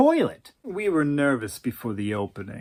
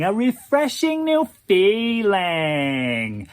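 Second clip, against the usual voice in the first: very slow echo, even more drawn out; slower airy drawl; 5 semitones higher, 3 dB louder, much slower than usual. Acted. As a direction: repeat it savoring every word. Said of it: "in that airy drawl of his".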